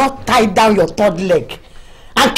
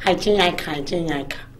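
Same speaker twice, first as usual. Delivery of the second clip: low, much softer mocking imitation; mocking mimic; 7 semitones lower, 9 dB quieter than usual. Wife mocks her husband's excuse in a low muttering mimic. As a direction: mutter his excuse back at him.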